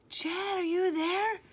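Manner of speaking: high, anxious call into the reeds; scared calling. A narrator's reading. Sid calling for his missing friend, scared. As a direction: call out, half afraid of the answer.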